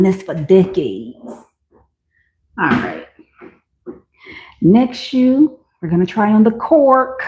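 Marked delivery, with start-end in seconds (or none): none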